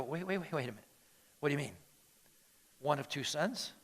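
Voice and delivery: soft, puzzled protest; quietly taken aback. Acted. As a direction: question it under your breath. Acted hesitant listener's reaction.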